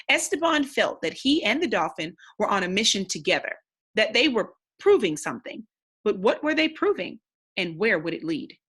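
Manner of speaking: steady, expressive read-aloud narration; story narration. Acted. Teacher reading the novel to the class, storytelling narration. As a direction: read the chapter aloud to young listeners.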